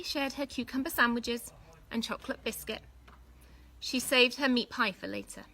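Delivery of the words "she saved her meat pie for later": plain reading voice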